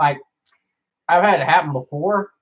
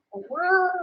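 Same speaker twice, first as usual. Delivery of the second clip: high, soft startled question; startled where; 12 semitones higher, 6 dB quieter than usual. Retold or imitated. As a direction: squeak it out in alarm. Reacting to creepy roommate.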